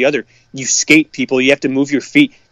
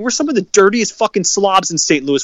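high, exasperated outburst; angry rant; 6 semitones higher than usual. Real, not acted. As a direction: yell shut up at the whiners, pitched way up. Ranting at fans.

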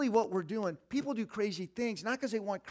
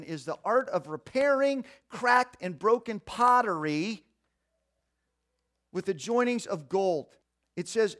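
loud, slow emphatic reading; fervent reading; 3 semitones higher, 10 dB louder than usual. Acted. Preacher reading the kintsugi text aloud to the church. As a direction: read it out like scripture.

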